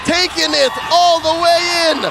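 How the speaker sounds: loud, triumphant win call; esports caster. Acted. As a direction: announce the victory big. Game-winning call.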